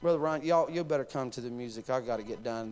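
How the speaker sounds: ordinary talk, nothing marked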